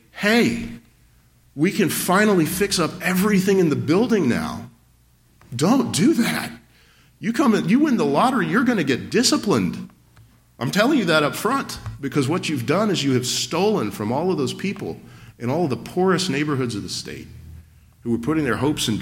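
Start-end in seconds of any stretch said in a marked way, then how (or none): 0.1-4.7 a light hint of an excited lottery winner, higher; mock the eager winner lightly; eager churchgoer. Lottery winner pitching the church a repair fund, lightly mocked excitement. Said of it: "don't come to this church and say". 5.5-17.3 fired-up preaching. Acted. Preacher warning the congregation about lottery wins, fervently preaching.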